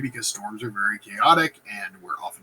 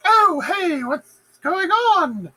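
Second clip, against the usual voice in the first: much higher, slow sing-song innocence; fake innocent; 5 dB louder, much slower than usual. Lady Macbeth arriving, feigning innocence in a high sing-song. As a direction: play the clueless lady sweetly. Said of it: "she's like".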